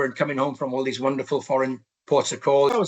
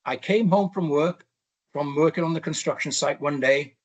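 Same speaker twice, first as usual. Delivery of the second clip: ordinary delivery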